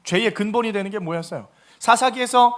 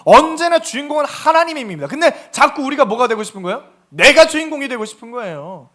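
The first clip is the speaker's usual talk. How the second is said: preaching loud; 4 semitones higher, 7 dB louder than usual. Real, not acted. Preaching peak.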